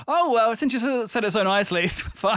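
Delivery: normal talking voice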